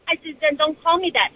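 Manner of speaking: usual speaking voice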